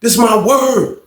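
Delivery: high shouted call; hollering upstairs; shouting through door. Retold or imitated. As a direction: yell it through the vent. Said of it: "He like".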